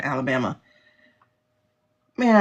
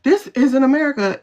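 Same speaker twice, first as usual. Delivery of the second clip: mocking fake shock; 7 semitones higher, 4 dB louder than usual. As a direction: parody naive outrage. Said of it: "now it's like".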